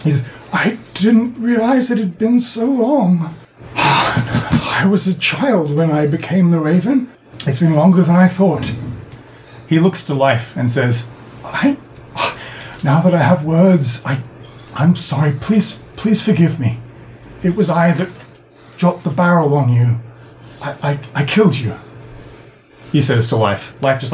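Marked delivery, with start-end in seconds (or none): 0.5-8.7 put-on confused old man. Old man to his rescuers on lost years, dazed. 11.4-21.8 put-on halting, remorseful old man's confession; frightened old man. Old man confessing to the one he killed, remorseful. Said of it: "looks with frightened eyes".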